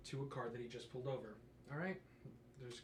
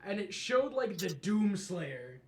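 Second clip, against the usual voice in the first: much higher, loud, slow build to the punchline; shouted punchline; 6 semitones higher, 17 dB louder, much slower than usual. Real, not acted. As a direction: belt out the meme's reveal.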